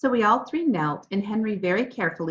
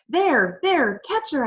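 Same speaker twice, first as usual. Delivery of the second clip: high, loud scream to the others; 7 semitones higher, 5 dB louder, slower than usual. A narrator's reading. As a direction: yell it out as you spot her. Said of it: "I screamed".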